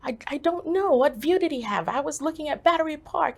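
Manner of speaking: high, loud, urgent protest; frantic reply. Retold or imitated. Answering a woman who seeks her husband, frantic reply.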